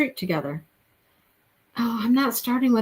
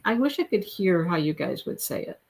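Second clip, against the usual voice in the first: ordinary talk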